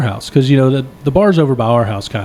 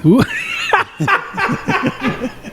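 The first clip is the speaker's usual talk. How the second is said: long, high-pitched laugh; big amused laugh; 4 dB quieter than usual. Real, not acted. Storyteller teased about his stretchy waist, laughing high. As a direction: burst into high, helpless laughter.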